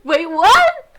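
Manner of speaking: loud, high startled yell; loud shocked wait what. Real, not acted. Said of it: "that was so loud".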